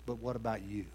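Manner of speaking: a hushed, direct challenge; quiet, searching question. Acted. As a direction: put it to them gently but squarely. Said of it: "looks them square in the eye and says".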